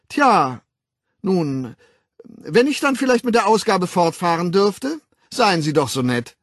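high-pitched, nervously polite coaxing; timid friendliness. A narrator's reading. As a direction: coax as if approaching a vicious animal. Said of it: "Mit der zaghaften Freundlichkeit dessen". (zaghaft freundlich)

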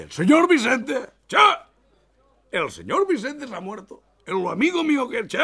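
high shocked cry; shocked disbelief. Acted. Customer shocked to hear his friend died, high. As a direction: gasp it out in disbelief.

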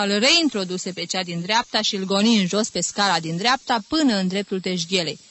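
ordinary delivery

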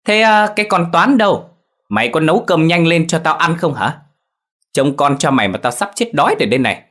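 very high, loud yelling shrew; shouting furiously. A narrator's reading. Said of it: "quát lớn". (quát thét the thé, rất cao và to)

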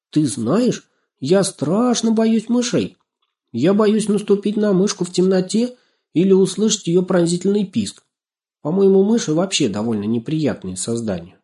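high, emphatic dread easing off; frightened girl. A narrator's reading. Girl admitting she is scared of mice, frightened. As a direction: confess a fear with feeling. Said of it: "испугалась Настенька".

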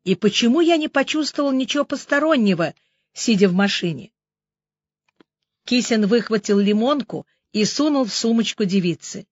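usual speaking voice

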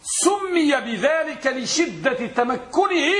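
teacher spelling out the key answer; emphatic answer. Shown as a demonstration. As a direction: stress تمكنه to drive it home. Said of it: "الجواب".

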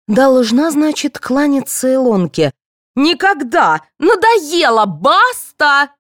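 high, indignant, building to a cry; angry outburst. A narrator's reading. Actress refusing to bow to her rival, furious outburst. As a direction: explode that you've had enough.

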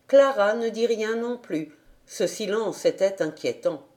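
usual speaking voice

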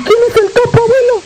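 put-on high childlike voice asking grandpa; a little kid, curious and high; high child's voice, eager question; small boy asking.

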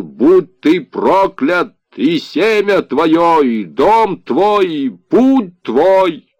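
slow, loud, high cry of damnation; guttural shouted curse. A narrator's reading. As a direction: hurl each phrase out harshly, stretched. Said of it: "гортанным резким голосом он прокричал". (гортанный резкий крик-проклятие, медленно)

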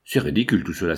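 usual speaking voice

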